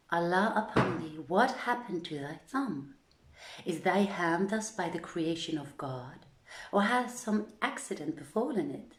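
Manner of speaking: plain reading voice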